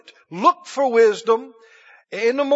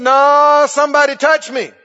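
emphatic insistence; 5 semitones higher, 9 dB louder than usual. Acted. Jesus overruling his disciples, loud insistence. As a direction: insist loudly, brooking no argument.

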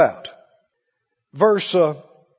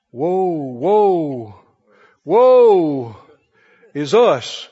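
low, drawn-out doom-preacher wail; doom prophet's tone; 6 semitones lower, much slower than usual. Retold or imitated. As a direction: intone woe like a mock prophet of doom. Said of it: "you hear that tone in their voice".

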